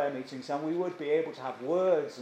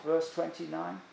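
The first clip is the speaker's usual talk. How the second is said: ordinary delivery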